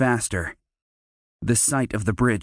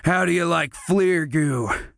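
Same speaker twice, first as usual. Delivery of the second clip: higher sneering leer; gloating leer at the captive; 5 semitones higher than usual. Acted. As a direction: relish the threat. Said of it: "Derek leered".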